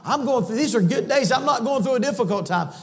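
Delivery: high, bright mock-carefree voice; mock cheerful listener. Preacher mimicking a complacent believer, mock-cheerful and high. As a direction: play the smug believer who thinks all is fine. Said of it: "You say".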